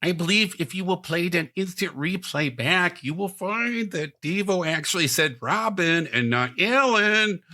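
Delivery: formal appeal in a bad German accent. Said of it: "the worst German accent".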